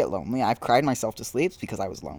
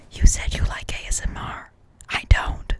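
breathy ASMR whisper right into the mic; 4 dB louder than usual. Pretend. Mock ASMR, whispering to sister.

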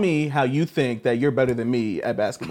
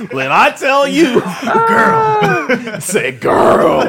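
laughing shout; 12 semitones higher, 8 dB louder, much slower than usual. Retold or imitated. Acting out a sassy reaction.

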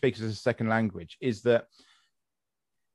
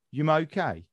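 Brummie "Yum okay?", "are you" merged into "Yum"; Brummie accent. Shown as a demonstration. Repeating the Birmingham example, Brummie accent. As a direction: ask it as in Birmingham, starting on "Yum". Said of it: "If you're in Birmingham".

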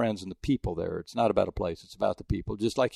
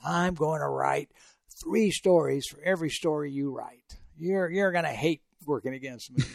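put-on a friend's accent tried out, higher and hushed; attempted McClain accent; 5 semitones higher, 4 dB quieter than usual. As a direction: try your buddy's accent, a bit higher and breathy. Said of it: "I can't do the McLean accent, but I'll kinda try".

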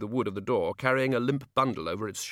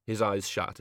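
ordinary delivery

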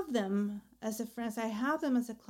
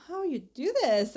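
amazed; 5 semitones higher, 5 dB louder than usual. Retold or imitated. Amazed question about friends' success.

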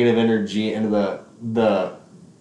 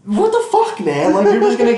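laughing disbelief; 10 semitones higher, 6 dB louder than usual. Real, not acted. Laughing disbelief at retold texts.

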